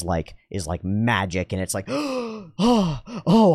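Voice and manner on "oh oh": high gasping "oh" of sudden relief; amazed relief. Acted. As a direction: act out a sudden wondrous realization.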